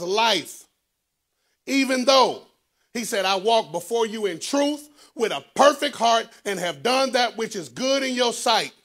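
high, loud sermon delivery; full-force preaching. Acted. Preacher telling the church of Hezekiah's prayer, full-force preaching.

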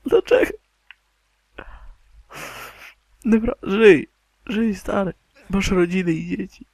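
high-pitched begging; emotional pleading. Pretend. Gamer begging companion to live for family, high emotional plea. Begs game character to live.

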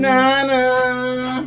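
high, loud, drawn-out call. Real, not acted. Calling Nana over during the unpacking, loud and high. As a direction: holler for someone across the room.

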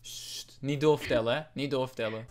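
low, hushed aside; confiding a secret. Acted. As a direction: keep it low and soft, just between us.

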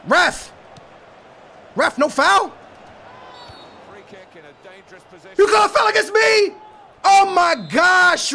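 loud, high protest at the ref; outraged appeal to the ref; pleading with the referee. Real, not acted. Protesting a foul call.

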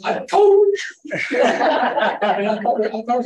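laughing high and loud through the words. Real, not acted. Teasing his wife about the missed light, laughing. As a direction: tease her through a laugh.

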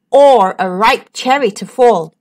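ordinary delivery, nothing marked

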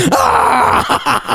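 sudden high delighted yell; group yell. Real, not acted. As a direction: let out a burst of shocked glee. Whole bus erupts at prank.

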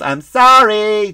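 loud, high, stretched mock sorry; shouted back, hammed-up and insincere; sarcastic apology. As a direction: drag it out with exaggerated sarcasm. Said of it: "just, like, sarcastically".

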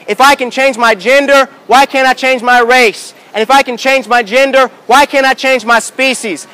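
usual speaking voice

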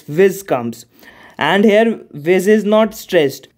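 usual speaking voice

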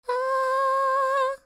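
high, loud, held-out vowel. A narrator's reading. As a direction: sustain it loudly at a high pitch. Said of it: "mit erhöhter Stimme".

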